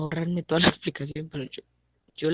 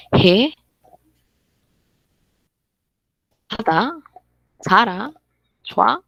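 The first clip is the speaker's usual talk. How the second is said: "He, hada, sara, chua" each one higher and loud, rising like questions; question intonation; 6 semitones higher, 9 dB louder, slower than usual. Shown as a demonstration. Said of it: "la entonacioncita es vital". ("He, hada, sara, chua" con entonación interrogativa, alta y ascendente)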